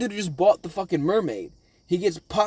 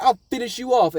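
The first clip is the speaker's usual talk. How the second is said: raised, high-pitched mock threat; threatening villain; 8 semitones higher, 4 dB louder than usual. Shooter threatening the wounded fish man, mock menace. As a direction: throw out the threat in a higher voice. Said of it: "He's like".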